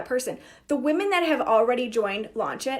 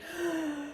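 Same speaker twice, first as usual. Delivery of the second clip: anxious gasp; 5 semitones higher, 9 dB quieter than usual. Retold or imitated. Anxious spiral begins.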